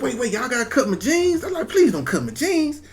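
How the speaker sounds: high, whiny mock plea; pleading for jeans. Patient begging staff not to cut his jeans, high pleading whine. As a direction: beg high-pitched, half laughing.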